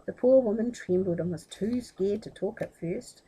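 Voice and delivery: ordinary delivery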